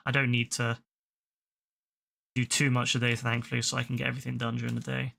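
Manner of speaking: talking with a sore tongue. Real, not acted. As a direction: speak as if the tongue hurts. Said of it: "my tongue is so sore".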